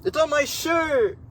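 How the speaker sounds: slow, very high squeal; high-pitched shriek. Real, not acted. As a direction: squeal it out in horror. Spill again, shrieking.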